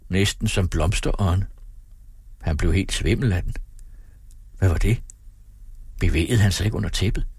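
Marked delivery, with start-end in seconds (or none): none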